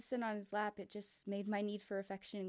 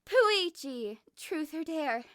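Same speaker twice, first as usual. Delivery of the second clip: Miu loud ask; 6 semitones higher, 11 dB louder, much slower than usual. Acted. Miu asks loudly.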